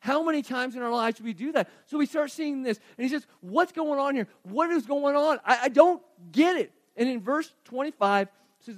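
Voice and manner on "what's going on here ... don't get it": mildly hammed-up bewilderment, higher and louder; baffled. David trying to fathom the army, baffled. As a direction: raise it in acted disbelief.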